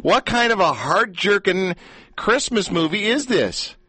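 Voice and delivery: high, slow, mock indignant question; mock outrage.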